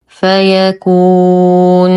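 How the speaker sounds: "فيكون" recited with the ū drawn out to a silent-ended noon; long madd at stop. Shown as a demonstration. Teacher models stopping on the word, long-held "فيكون". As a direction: stretch the last vowel of "فيكون". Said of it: "فالمد عارض للسكون يمد بمقدار اثنين".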